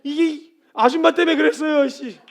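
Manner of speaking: loud, high mock outburst; indignant blaming. Peter blaming the woman to Jesus, mock indignant. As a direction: shift the blame at full volume.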